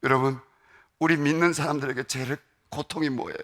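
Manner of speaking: normal talking voice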